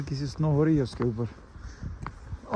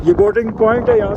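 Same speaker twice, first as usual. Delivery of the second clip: loud, raised voice in the wind; shouting over wind; 6 semitones higher, 8 dB louder than usual. Real, not acted. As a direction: project it over the gusts. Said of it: "इससे ज़्यादा तेज़ मैं बोल नहीं सकता".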